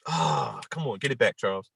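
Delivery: low, soft aside to himself; real private self-talk, low and quiet; muttering to self. Real, not acted. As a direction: drop right down and coax yourself back on track. Collecting himself, talking to himself.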